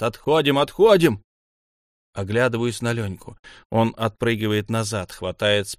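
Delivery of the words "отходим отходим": urgent command, second louder and higher; rising, repeated yell; shouting. A narrator's reading. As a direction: shout everyone back from danger. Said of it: "кричат".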